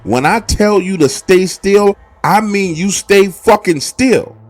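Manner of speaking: angry father. Retold or imitated. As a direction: chew him out, furious and loud. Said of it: "he is pissed".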